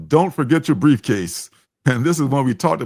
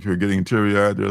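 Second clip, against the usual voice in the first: ordinary talk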